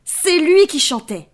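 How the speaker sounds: lion voice. Acted. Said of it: "dit le lion".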